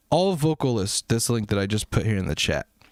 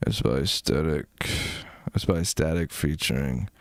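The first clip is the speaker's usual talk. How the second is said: much lower, subdued voice; really quiet; 7 semitones lower than usual. Shown as a demonstration. Streamer showing chat how he goes low on long lives. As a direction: drop way down to save the voice. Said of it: "I'll be like this".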